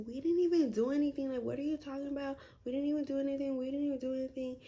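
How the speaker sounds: put-on hurried teen protest; defensive protest. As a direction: rush the denial, pleading innocence. Protesting to angry mom.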